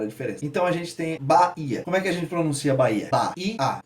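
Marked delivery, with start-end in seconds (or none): 3.1-3.8 syllable by syllable. Shown as a demonstration. Teacher counting three syllables, syllable by syllable. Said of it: "Três sílabas".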